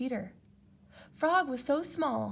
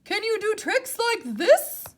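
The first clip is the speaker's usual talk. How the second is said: high, loud boast; boastful Toad; 11 semitones higher, 9 dB louder than usual. Acted. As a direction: brag to Frog.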